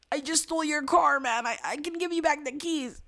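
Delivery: high, thin pleading voice; desperate pleading. Acted. Wounded Jessica pleading with the man who shot her, high desperate voice.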